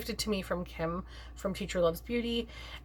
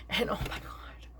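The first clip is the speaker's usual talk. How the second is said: whisper; 6 dB quieter than usual. Real, not acted. Can't find product again.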